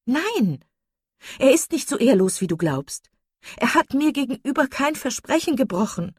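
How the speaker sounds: high, loud, agitated protest; vehement defence. A narrator's reading. Marian defends the man to her doubting sister, vehement.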